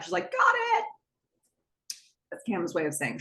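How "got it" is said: very high-pitched mock yell; high, mock-irritated call; retold shout back. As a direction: imitate a curt shout back, pitched right up without much volume. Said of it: "she shouts back".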